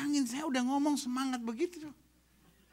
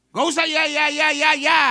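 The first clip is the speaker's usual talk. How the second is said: loud dismissal; 3 semitones higher, 9 dB louder than usual. Acted. Preacher dismissing.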